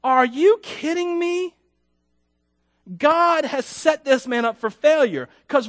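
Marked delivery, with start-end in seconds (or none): 0.0-1.5 incredulous, much higher and slow; a stunned, slowed-down question; disbelief. Retold or imitated. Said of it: "we look at this and go".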